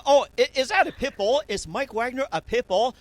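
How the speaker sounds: high, loud excited pup voice; dog's voice. Retold or imitated. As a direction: speak as the eager dog. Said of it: "he goes".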